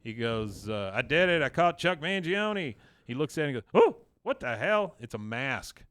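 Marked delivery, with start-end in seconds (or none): 0.9-2.8 mock-triumphant retelling, louder and higher; mildly crowing over a catch; acted-out victory cry. 3.7-4.9 mock startled outburst, high and loud; retold shock, pitch leaping up. Retelling a startled discovery.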